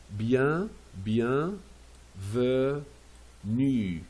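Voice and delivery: "Bien, bien, venu" with long gaps between parts; syllable-by-syllable model. Shown as a demonstration. Teacher showing pronunciation, separated syllables. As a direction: isolate "Bien", then "venu", for learners. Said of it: "c'est pour la prononciation".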